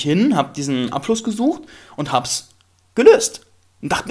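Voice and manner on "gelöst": gelöst jumps very high and louder; high proud gelöst; triumphant. Real, not acted. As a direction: crow it out, proud of the fix. Proud of fixing the toilet.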